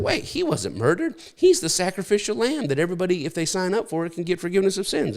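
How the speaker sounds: high-pitched imitated believer, hurrying at the end; mock objector. Somebody correcting the story of Jesus's death, mocked in a high voice. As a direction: pitch it well up as a parody of eager doctrine.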